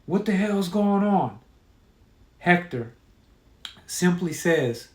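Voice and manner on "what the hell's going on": understated angry yell, higher pitch; mildly yelled, irritated demand. A narrator's reading. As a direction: narrate the sheriff's anger, raised but contained. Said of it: "The sheriff gets angry, yelling".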